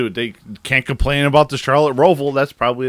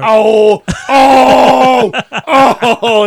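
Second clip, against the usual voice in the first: excited shout; 11 semitones higher, 11 dB louder, much slower than usual. Real, not acted. Reacting to crash clip.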